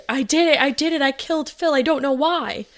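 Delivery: high, frantic mock wail, a retold scream and sob; hysterical sobbing. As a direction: cry it out in a high panicked voice as if it just happened. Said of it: "Bryn started screaming and sobbing".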